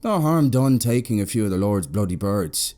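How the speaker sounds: hearty uncle. A narrator's reading. Uncle laughing, slapping table.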